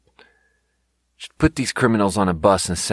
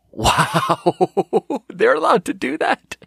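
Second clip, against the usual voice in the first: laughing disbelief; 12 semitones higher, much slower than usual. Real, not acted. Amazed at sheriff story.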